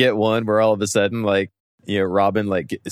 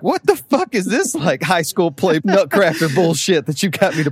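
put-on high, loud indignant boy voice; hammed-up squeaky protest; outraged Robin; 8 semitones higher, 4 dB louder than usual.

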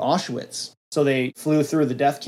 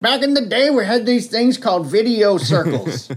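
put-on much higher, creaky old-man teasing; mock old-timer; 5 semitones higher than usual. Teasing old friend, mock old-man voice. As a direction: play a doddering old-timer.

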